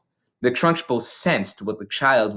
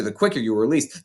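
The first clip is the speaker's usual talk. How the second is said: ordinary delivery, nothing marked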